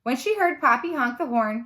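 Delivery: plain reading voice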